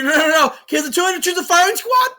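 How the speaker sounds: sputtering official cutting in; mock indignant protest. Retold objection in film scene.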